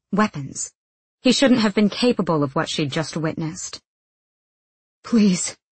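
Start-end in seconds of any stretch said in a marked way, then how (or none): 5.0-5.6 mild, understated plea; a touch of desperation; begging. A narrator's reading. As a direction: beg weakly. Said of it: "he begged".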